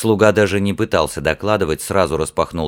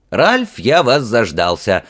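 slow, higher, louder cry of welcome; eager exclamation; 5 semitones higher, 5 dB louder, slower than usual. A narrator's reading. Prince greeting Ralph on seeing him, exclaiming eagerly. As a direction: exclaim it with drawn-out impatience. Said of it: "воскликнул принц".

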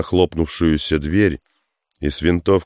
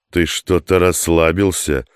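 plain reading voice

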